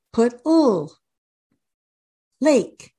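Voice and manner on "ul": "ul" as the lone sound of l, held; isolated letter sound. Shown as a demonstration. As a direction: sound out "ul" as a drawn-out ull. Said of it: "You wanna say the sound".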